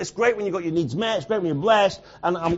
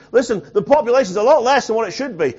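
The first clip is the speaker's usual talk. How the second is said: high, loud preacher in full flow; impassioned preaching; 6 semitones higher, 7 dB louder than usual. Real, not acted. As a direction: drive it home to the congregation, loud and high.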